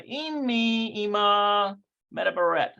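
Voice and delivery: Hebrew read slowly, syllable by syllable; "Immi ima medaberet" sounded out carefully; slow reading aloud. Shown as a demonstration. Teacher sounding out the Hebrew sentence for the class, slowly. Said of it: "let's see".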